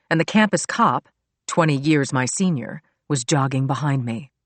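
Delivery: ordinary delivery, nothing marked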